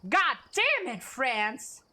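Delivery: sudden high, loud curse; a loud cry of annoyance; angry outburst. Real, not acted. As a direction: blurt it out in frustration. Reacting to a game result.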